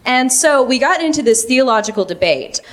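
ordinary talk, nothing marked

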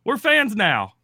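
high, loud whoop easing off; put-on burst of celebration; mock cheering. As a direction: whoop like delighted new fans. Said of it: "they're like".

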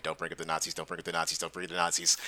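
low, soft, hurried repetition; inner self-warning. Retold or imitated. Nervous guy warning himself mid-conversation with a German woman, hushed inner voice. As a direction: repeat it under your breath like a mantra. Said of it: "in the back of my mind going".

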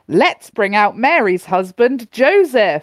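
loud, drawn-out show introduction; talk-show host. Acted. Host bringing out Mary's husband, big showy announcement. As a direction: announce the guest with a big, slow build.

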